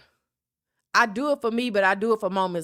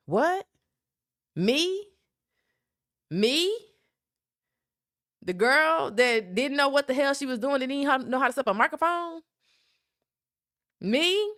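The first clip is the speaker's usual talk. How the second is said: higher, incredulous questions; amazed disbelief; 6 semitones higher than usual. Real, not acted. Reacting to praise from listeners, in amazed disbelief. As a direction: ask it like you can't believe it's you.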